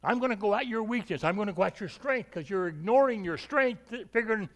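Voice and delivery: lightly voiced scheming devil. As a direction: plot your attack like a cunning enemy.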